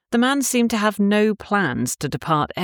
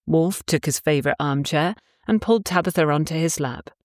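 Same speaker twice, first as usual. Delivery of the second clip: usual speaking voice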